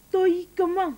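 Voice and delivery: stoïquement pushed high and loud; emphatic. Acted. Wife boasting of her sacrifices, stressing stoïquement. Mock heroic self-praise.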